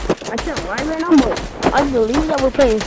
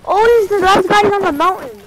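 loud, higher, stunned cry; shocked shout; 6 semitones higher, 8 dB louder, slower than usual. Real, not acted. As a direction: yell out in real disbelief. Shocked at sudden death in game.

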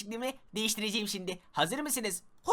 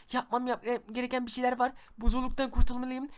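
ordinary talk

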